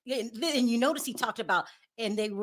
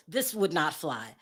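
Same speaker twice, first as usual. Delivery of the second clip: ordinary talk, nothing marked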